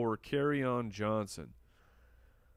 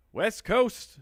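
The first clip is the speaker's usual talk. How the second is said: higher, louder go at an Australian accent; attempted Australian accent; 9 semitones higher, 6 dB louder than usual. Shown as a demonstration. Host tries a viewer's name request, attempted Australian accent. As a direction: have a poor stab at sounding Australian. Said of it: "No, that was not very good".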